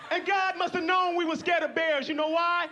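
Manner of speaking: normal talking voice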